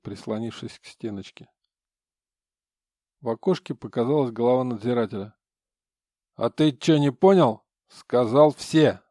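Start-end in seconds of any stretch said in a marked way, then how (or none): 6.3-9.0 loud, rude jailer yelling; bully him through the hatch; rough guard shout. Acted. Надзиратель грубо кричит в окошко.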